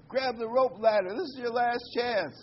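final plea shouted down; lightly voiced rescuer, last chance; urgent last warning. Helicopter crew final warning.

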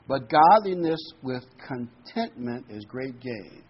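slow down and hit "contentment" hard; measured verse reading, "contentment" strongly emphasized; stress on contentment. Real, not acted. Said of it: "I emphasize the word contentment".

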